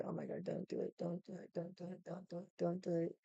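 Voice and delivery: very soft repeated urging under the breath; hushed pleading. Retold or imitated. Friend beside her dreading a fight, hushed mock pleading.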